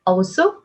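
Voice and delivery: Also lifted at the end; rising intonation. Shown as a demonstration. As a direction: raise Also as an opener. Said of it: "억양 올려주시고". (Also 끝 억양을 올려서)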